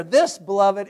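usual speaking voice

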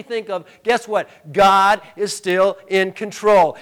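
loud, rising pulpit preaching; full-force preaching. Acted. Pastor preaching God's sovereignty at full force. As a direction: build it up to the church.

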